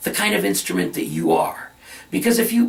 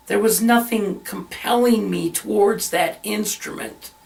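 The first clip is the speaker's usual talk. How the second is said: ordinary talk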